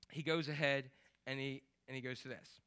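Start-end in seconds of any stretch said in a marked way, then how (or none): none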